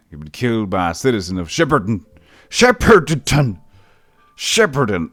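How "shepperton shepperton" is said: loud, high, drawn-out wail; hammed-up loud outrage; outraged cry. Real, not acted. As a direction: cry out the town name in mock despair. Outraged at death message.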